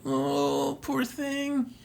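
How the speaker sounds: soft coo rising high; tender cooing. Acted. Samus cradling the hatchling, soft high cooing. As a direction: coo gently, drawing out the sympathy. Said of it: "starts to cradle the thing".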